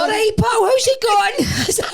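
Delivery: hammed-up matey hello, stretched out; squeaky mock-friendly greeting to a stranger; mock cheery greeting. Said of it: "I'm like".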